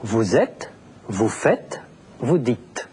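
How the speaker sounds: usual speaking voice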